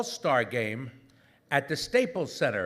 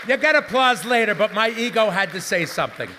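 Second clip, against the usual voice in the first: louder joking aside; 5 semitones higher, 7 dB louder than usual. Real, not acted.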